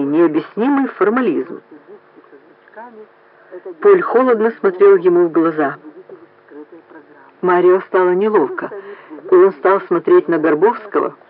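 ordinary delivery

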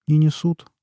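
a touch guilty, mumbled excuse; mildly sheepish underling; lightly guilty "Не несут", said apologetically. A narrator's reading. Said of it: "виновато сказал Масул".

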